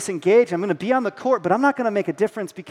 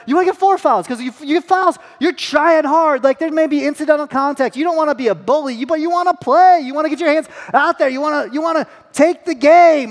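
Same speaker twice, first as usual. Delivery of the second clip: higher and louder pep-talk; hyped coaching; 8 semitones higher, 5 dB louder than usual. Retold or imitated. Dad firing up his son about playing hard, excited coaching. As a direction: pump the kid up like a coach.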